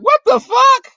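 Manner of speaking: very high, loud outburst of disbelief; shocked shout. Retold or imitated. Walking in on friends mid-fight, shouting in shock. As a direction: scream it the moment you see the mess.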